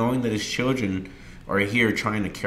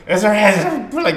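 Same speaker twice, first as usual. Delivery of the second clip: fast, much higher and louder comforting; loud hurried reassurance; 13 semitones higher, 8 dB louder, faster than usual. Retold or imitated. Son reassuring his crying dad, loud and fast. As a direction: rush to calm him, almost shouting.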